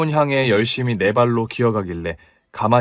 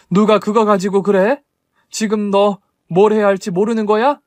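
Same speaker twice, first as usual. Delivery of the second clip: louder, higher scolding mother; angry scold; 7 semitones higher, 4 dB louder than usual. Acted. Mother scolds her son for hiding things, sharp angry scold. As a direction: snap at him to wake up.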